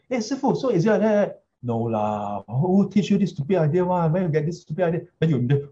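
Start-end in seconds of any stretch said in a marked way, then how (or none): 0.1-1.4 questioning student voice. Retold or imitated. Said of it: "ask Sifu". 1.6-5.0 scolding master voice. Retold or imitated. Sifu scolding the student.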